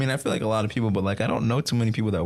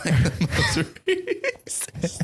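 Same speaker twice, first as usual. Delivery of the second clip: high, drawn-out words breaking into laughter; laughing; 7 semitones higher, much slower than usual. Real, not acted. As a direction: barely get it out through laughter. Cracks up retelling the comedian's line.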